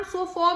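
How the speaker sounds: सोफा ending in 'faw', as in walk; walk-vowel ending. Shown as a demonstration. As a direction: say सोफा as 'so-faw' with walk's vowel. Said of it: "हम वॉक में ए की आवाज ओ होती है". (सोफा के अंत में वॉक वाला 'ऑ')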